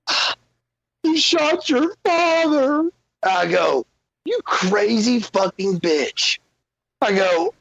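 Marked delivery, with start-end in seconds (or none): 0.1-2.9 very high, drawn-out mock wail; horrified mother. Retold mother finding out father shot. 4.2-6.4 high, slow exasperated retort; retold outrage. Retold angry reply to mother.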